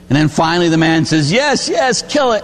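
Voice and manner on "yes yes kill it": high-pitched acted giving-in; desperate consent. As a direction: blurt permission in a high, pleading voice. Man finally gives in, acted.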